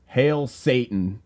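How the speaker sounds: slow drawn-out mild mock yell; mock scream. Hooper toasting at the bar with a mild mock yell. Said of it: "Hooper screamed".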